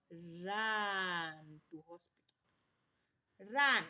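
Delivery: slow urgent order, last word shouted; urgent command. Shown as a demonstration. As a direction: press the warning hard, then bark Run. Urgent warning to mothers.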